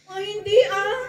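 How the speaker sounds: high, loud nervous brush-off; flustered denial. Acted. Peter denying he knows Jesus, high loud nervous voice. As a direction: deny it fast, voice jumping up.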